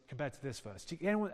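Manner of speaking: usual speaking voice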